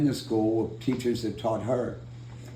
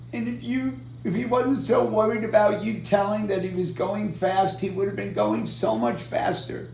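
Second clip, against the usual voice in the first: much higher, tearful imitation of a sobbing wife; tearful grateful wife; 10 semitones higher than usual. Crash survivor imitates the crying wife thanking him, high and tearful. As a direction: voice her thanks high and choked up, mimicking her tears. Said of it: "she's crying her eyes out".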